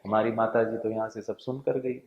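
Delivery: usual speaking voice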